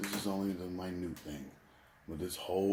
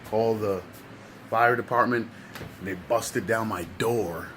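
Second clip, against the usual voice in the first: building loud; 4 semitones higher, 7 dB louder than usual. Real, not acted. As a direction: build to a loud, dramatic telling. Dramatic retelling of rescue.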